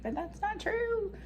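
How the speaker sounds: high, sweet little angel voice; a gentle angel pleading, high and tender. Retold or imitated. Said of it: "the little angel on the other side's like".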